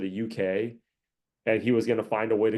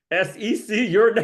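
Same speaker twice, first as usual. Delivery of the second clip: higher and louder, a hammed-up warning; mock threat; 8 semitones higher, 6 dB louder than usual. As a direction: read the tweet like a taunt. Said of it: "You guys see the latest tweet?".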